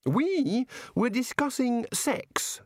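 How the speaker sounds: high, slow relish; innocently thrilled announcement; enthusiastic. Acted. God telling Missus Whitlow the topic, enthusiastically. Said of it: "said the god enthusiastically".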